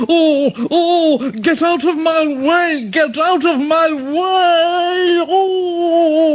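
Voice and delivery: high panicked yell, slowing on the repeat; high frantic engine wail; Gordon yelling. A narrator's reading. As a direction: cry out to clear the line. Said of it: "Gordon yelled".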